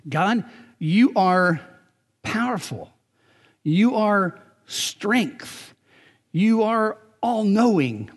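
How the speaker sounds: a worshipper crying out God's greatness; prayerful cry. Pretend. Prayer voiced to God.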